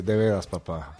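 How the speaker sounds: put-on low, unimpressed teen reproach; disapproving daughter. Daughter to dad after his little joke, disapproving. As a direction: imitate a daughter's weary disbelief, pitched low. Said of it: "me levanta las cejas así".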